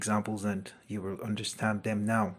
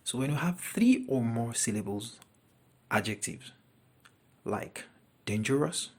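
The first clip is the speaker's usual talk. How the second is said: ordinary talk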